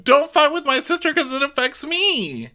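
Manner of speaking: very high mock whine; a high, self-centered whine; mocking Kyle. Host mocking Kyle's self-centered plea in a high whine.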